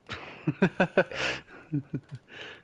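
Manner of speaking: long chuckle after trash talk; laughter. Real, not acted. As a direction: laugh at a beaten rival.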